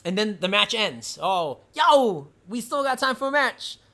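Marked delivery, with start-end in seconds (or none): 1.2-3.8 higher, lively imitation of a challenge; half-shouted mock ring call. Acting out wrestler calling opponent down.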